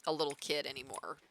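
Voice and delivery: ordinary talk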